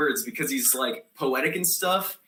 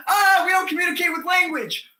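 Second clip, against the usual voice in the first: high, frantic yell; screamed refusal; 11 semitones higher, 4 dB louder than usual. Acted. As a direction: scream back while fleeing. Acts out screaming refusal to cop.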